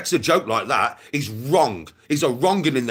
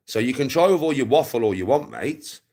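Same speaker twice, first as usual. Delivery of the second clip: ordinary delivery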